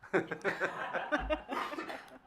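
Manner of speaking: a real burst of high laughter; hearty laugh. Real, not acted. Panelist laughing at a fellow panelist's quip, real laugh. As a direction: crack up at the joke. Laughs at inverse Venn joke.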